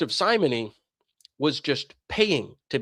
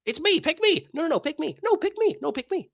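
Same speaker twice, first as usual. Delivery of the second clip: much higher, pleading mock voices; be a needy group begging to be chosen; squeaky mock clamouring; 12 semitones higher than usual. Rival churches to the seeker, squeaky and pleading.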